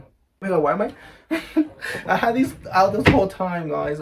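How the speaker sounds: high, loud laughing fluster; embarrassed laugh. Real, not acted. Noticing her outfit on camera, embarrassed laughing. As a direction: caught on camera, laughing at herself. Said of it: "So embarrassing".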